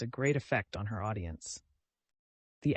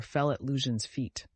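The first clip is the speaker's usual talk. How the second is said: ordinary delivery, nothing marked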